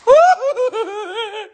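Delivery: high, whooping laugh; nervous laugh. Real, not acted. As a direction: let out a long high-pitched giggle. Reacting to seeing the alligator.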